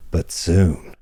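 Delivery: plain reading voice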